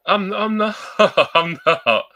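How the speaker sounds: high, loud laughing denial. Real, not acted. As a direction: break into a laugh while backtracking. Joking denial of own fib.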